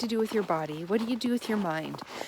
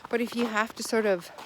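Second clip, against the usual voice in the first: ordinary talk, nothing marked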